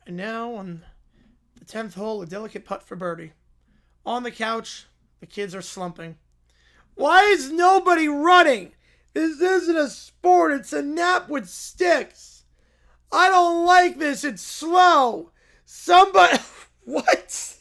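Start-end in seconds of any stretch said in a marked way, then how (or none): 0.1-3.3 hushed golf commentary. Acted. 6.9-16.4 bratty complaint. Acted. Bored kid complaining loudly.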